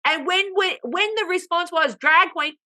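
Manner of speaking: high-pitched exasperated disbelief; scandalised, high and emphatic; incredulous rant. Real, not acted. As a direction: rant upward in comic outrage. Incredulous rant about oblivious woman.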